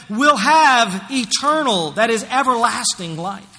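slow, loud, high preaching; forceful scripture reading. Acted. Preacher reading scripture to the church, slow and loud. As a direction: proclaim each word slowly to the congregation.

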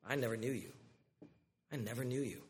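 very soft, low and grave; quiet verdict. Acted. Jesus telling false believers he never knew them, hushed. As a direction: let the verdict fall quietly.

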